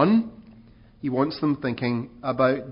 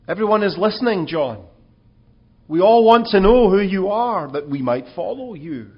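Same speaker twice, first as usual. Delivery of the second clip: louder, higher eager plea; eager urging; 6 semitones higher, 6 dB louder than usual. Acted. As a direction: coax him toward fame. Crowd urging John to claim fame.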